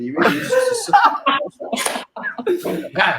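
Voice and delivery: cracking up mid-insult; laughing. Real, not acted. Laughing at his own jab.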